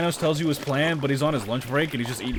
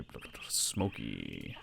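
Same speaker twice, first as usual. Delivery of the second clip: mumbled; 14 dB quieter, slower than usual. Real, not acted. Mumbling through game text.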